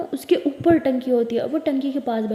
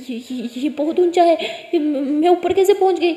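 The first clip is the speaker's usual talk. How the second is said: higher, louder, panicked voice; sudden fear of heights; 4 semitones higher, 6 dB louder than usual. A narrator's reading. Jess on the roof suddenly sees the drop, frightened. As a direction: gasp it out as the height hits her. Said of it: "वो वहीं पर डरने लग जाती है".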